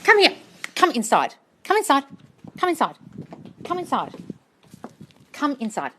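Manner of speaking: loud high-pitched dog-calling commands. Real, not acted. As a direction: call her in firmly, again and again. Calling dog indoors repeatedly.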